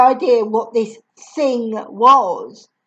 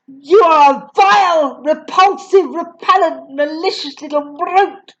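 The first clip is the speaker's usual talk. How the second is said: higher, louder scolding; furious tirade; 5 semitones higher, 4 dB louder than usual. Acted. Headmistress berating Matilda, higher and louder. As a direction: pile the insults on. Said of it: "the Trunchbull was saying".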